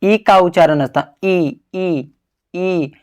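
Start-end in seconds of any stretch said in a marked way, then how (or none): 1.7-2.9 "ई" said alone, softer, drawn out for the pupils; sound demo held soft. Shown as a demonstration. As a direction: show the vowel quietly and clearly. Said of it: "तालु से बोलते हैं". ("ई" को धीमी आवाज़ में, अकेले, खींचकर बोलें)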